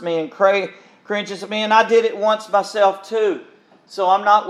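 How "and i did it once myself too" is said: ordinary delivery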